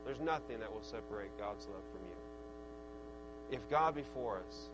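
low, soft sermon delivery; hushed preaching. Real, not acted. Preacher assuring the congregation of God's love, hushed preaching. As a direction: bring the verse down to a quiet, low assurance.

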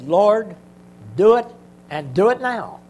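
loud, high, pleading cry to God; fervent shout. Retold or imitated. Preacher crying out to the Lord in a desperate demand. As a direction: cry it out, begging and demanding at once.

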